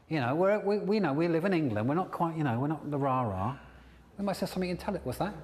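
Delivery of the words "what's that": usual speaking voice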